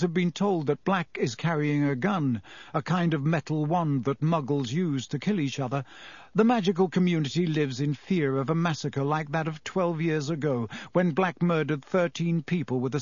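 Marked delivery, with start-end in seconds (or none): none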